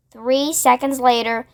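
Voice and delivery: usual speaking voice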